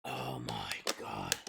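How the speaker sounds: breathless whisper, slow; a slow stunned whisper. Real, not acted. Reacting to spicy heat.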